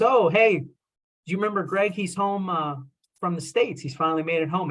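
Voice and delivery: high, bright imitation of the excited neighbour; cheerful greeting. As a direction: mimic her delighted hello, pitched well up. Acquaintance greets him, retold.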